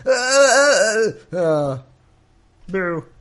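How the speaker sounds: hammed-up high wails trailing into a fake sob; mock weeping. Narrator parodies Jacob lifting up his voice and weeping, mock sobbing. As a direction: blubber theatrically, like an overdone crybaby.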